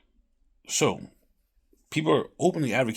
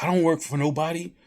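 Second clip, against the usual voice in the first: higher, louder bragging quote; mock entitled guy; 7 semitones higher, 4 dB louder than usual. Retelling a man who refuses to work, higher mock brag. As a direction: play the smug guy, pitched up. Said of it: "That's one guy's like".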